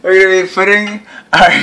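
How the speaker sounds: losing it mid-sentence; breaking into laughter. Real, not acted. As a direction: start the line brightly, then crack up. Retaking intro, cracks up laughing.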